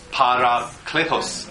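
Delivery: "Parakletos" slowly, split into its parts; syllable by syllable. Shown as a demonstration. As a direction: recap "Para-kletos" slowly for the class. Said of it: "pa四个音节".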